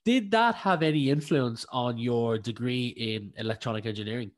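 usual speaking voice

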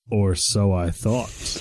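very slow, low, dramatic reveal; hammed-up ominous line, deep and slow; ominous story twist. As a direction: draw it out like a horror narrator.

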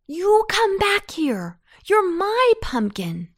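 put-on high, loud boy's order; indignant shout to come back; angry cry. A narrator's reading. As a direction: call after a runaway, loud and high. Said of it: "cried Anton".

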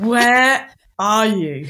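slow, loud, stretched-out mock demand; retold baffled question. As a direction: draw out each word, calling for a lost friend. Retold text to lost friend.

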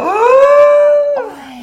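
very high, loud shocked cry; shocked gasp. Retold or imitated. Storyteller re-enacting seeing the proposal in print, shocked shriek. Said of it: "fikk jeg så karasjokk".

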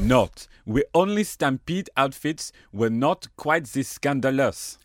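postcard read in a put-on French accent. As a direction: keep up the hammy French accent. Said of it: "I shall read in my, uh, French accent".

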